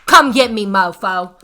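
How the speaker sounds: taunting the politicians, loud and cocky; defiant taunt. Real, not acted. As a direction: shout it out as a challenge.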